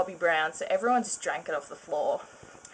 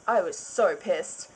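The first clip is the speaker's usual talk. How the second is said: usual speaking voice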